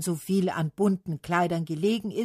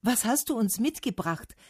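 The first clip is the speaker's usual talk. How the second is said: higher-pitched eager question; calling out; 6 semitones higher than usual. A narrator's reading. Trees calling to Autumn, calling out. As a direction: call out the question. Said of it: "riefen die Bäume".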